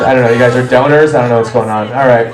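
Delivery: ordinary talk, nothing marked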